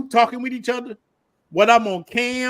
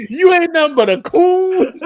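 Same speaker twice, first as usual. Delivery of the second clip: laughing while quoting hater; 6 semitones higher, 5 dB louder than usual. Retold or imitated. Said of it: "they tell me some of the most".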